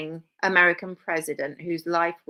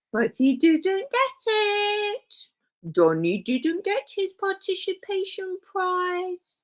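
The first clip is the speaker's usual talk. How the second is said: slow, much higher, babyish mock sympathy; mock pity; 12 semitones higher, slower than usual. Comic mock-consoling a toddler over Donny's lost prize, slow and high.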